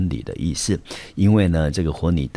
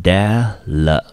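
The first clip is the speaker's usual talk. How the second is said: syllable by syllable; 3 semitones higher, 6 dB louder than usual. Shown as a demonstration. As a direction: sound out "delicacy" one syllable at a time. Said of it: "我们就念成". (把 "delicacy" 一个音节一个音节拆开大声慢念)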